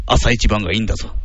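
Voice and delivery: lightly voiced low father role; advising father. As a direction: play a dad passing on advice. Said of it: "お父さん".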